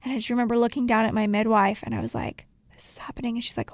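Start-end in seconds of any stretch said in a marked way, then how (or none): 2.7-3.4 hushed aside. Retold or imitated. Quoting herself at the birth stool.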